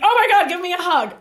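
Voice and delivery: high, loud, delighted exclamation; excited offer. Retold or imitated. [name] to awkward fan with arms up, excited. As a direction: imitate a star eagerly inviting a hug.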